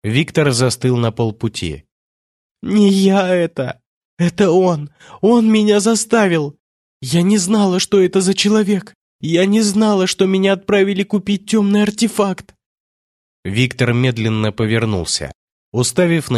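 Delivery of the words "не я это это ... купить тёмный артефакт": high-pitched, hysterical pleading; acted panicky, tearful protest; distraught outburst. A narrator's reading. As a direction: blurt out desperate denials at high pitch. Distraught woman's acted outburst of denial.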